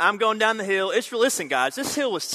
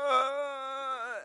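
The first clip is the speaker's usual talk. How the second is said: high, strained gasp for breath; winded groan; 11 semitones higher than usual. Acted. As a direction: moan as if the wind is knocked out. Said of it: "I'm like that, trying".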